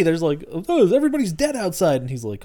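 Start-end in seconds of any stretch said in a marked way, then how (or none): 0.7-2.0 mock casual daughter. Daughter reports from window.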